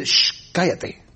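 shush, then tell them off under the voice. Acted. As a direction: act out a crowd silencing someone. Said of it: "La gente le empieza a decir".

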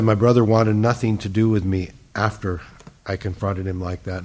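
usual speaking voice

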